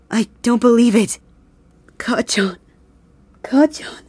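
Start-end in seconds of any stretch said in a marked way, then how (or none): 2.0-2.6 barely able to say it; broken whisper. Acted. Grieving over the body. 3.4-4.0 high, rising tearful call; calling out to him through tears; grieving cry. Acted. Grieving over the body.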